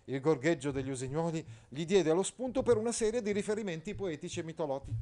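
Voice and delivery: ordinary delivery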